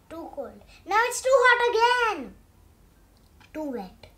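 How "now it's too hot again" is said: high, loud fussy whine; loud complaint. Acted. Pigeon fussing over bath water, high loud whine.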